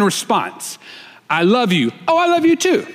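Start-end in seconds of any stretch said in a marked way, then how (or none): 2.1-2.8 high-pitched mock sweetheart reply; sweet reply. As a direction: pitch it way up, answering a partner's "I love you". Models wife's usual loving reply.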